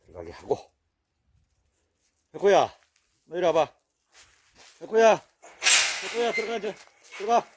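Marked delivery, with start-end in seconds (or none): 2.3-7.5 loud, high telling-off of a dog; scolding call. Real, not acted. Owner scolding the dog that bullies another, loud and high.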